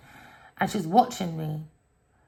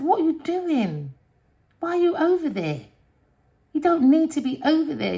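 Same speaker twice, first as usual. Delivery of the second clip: urgent pleading; 9 semitones higher than usual. Retold or imitated. Retelling her words in a dream.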